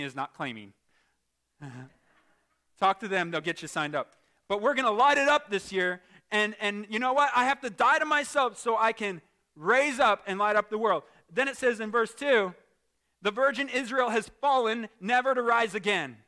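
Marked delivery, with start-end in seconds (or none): 4.5-16.2 raised, forceful pulpit voice; loud, emphatic sermon and scripture reading; fervent preaching. Real, not acted. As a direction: declare it boldly to the congregation.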